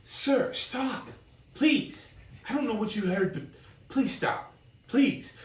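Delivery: high-pitched, urgent woman's voice; pleading girl. Acted. Girl begging the stranger to stop, high pleading. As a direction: beg in short high bursts. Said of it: "She turns around and is like".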